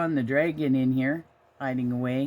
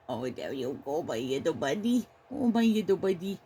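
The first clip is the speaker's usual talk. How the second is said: cooing to the dog. Real, not acted. Cooing to her nervous dog.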